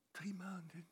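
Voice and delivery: whispered disbelieving echo; hushed, shocked patient's reply; stunned whisper. Retold or imitated. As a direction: repeat it under your breath, aghast. Patient stunned by doctor's answer.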